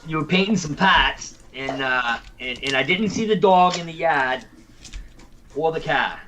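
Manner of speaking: put-on Boston accent with dropped r's; hammed-up Boston vowels. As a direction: tease in a broad Boston accent. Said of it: "Are we going with our best Boston accents".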